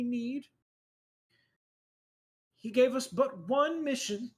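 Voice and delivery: high, solemn sermon voice; preacher voice. Acted. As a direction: preach with holy conviction. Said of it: "And he goes".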